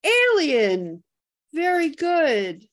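excited winner call. Real, not acted. Host announcing the giveaway winner, excited. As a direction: crow the winner's name.

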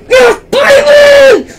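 very high, loud, slow celebratory shriek; voice cracking. Real, not acted. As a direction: shriek in triumph till the voice cracks. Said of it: "the weirdest voice crack".

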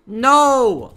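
high, loud "no"; drawn-out yell of dismay; dismayed shout. Real, not acted. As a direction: cry out in protest. Mishap in game.

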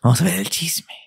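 hushed whisper; grandma's whisper. Retold or imitated. Grandma on the stairs calling her grandson to the gossip, whispered. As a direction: whisper it like sneaking to eavesdrop. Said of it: "me dijo".

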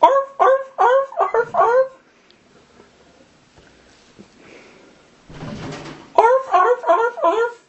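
high yapping dog barks; yappy little dog. Pretend. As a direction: bark like a tiny yappy dog. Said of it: "I call this one the Godzilla".

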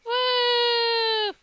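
long, high-pitched "wooo"; high squealed woo. Acted. Player squeals at the game.

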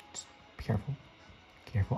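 hushed whisper while sneaking. Real, not acted. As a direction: whisper so he won't hear. Sneaking past monster.

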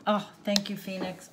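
ordinary talk, nothing marked

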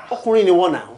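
loud sudden scolding outburst; scolding shout. Acted. Uncle shouts at the husband, scolding outburst. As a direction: cut through the fight with a loud scold.

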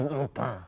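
"le pain" with a nasal French vowel; French pronunciation. Shown as a demonstration. Guest teaching host, nasal French "le pain". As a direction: say "le pain" the French way, final n swallowed. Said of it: "if you wanted to say it French".

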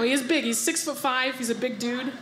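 ordinary talk, nothing marked

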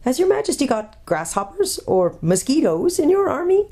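high-pitched, incredulous question; astonished. Acted. Doctor hearing a tiny voice underfoot, astonished. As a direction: ask in baffled amazement.

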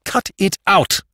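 high, forceful angry command; savage. A narrator's reading. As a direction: cut him off savagely, each word hit. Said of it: "said Jimmy savagely".

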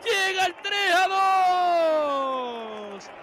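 high shouted goal cries; goal celebration. Real, not acted. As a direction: scream the winner out.

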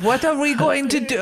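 high-pitched mock Mexican accent; hammed-up Mexican bandit accent, pitched well up; put-on Mexican accent. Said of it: "I shouldn't do an accent".

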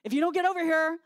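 loud, hammed-up cries of dying; imitating yelling. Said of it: "Derek starts yelling".

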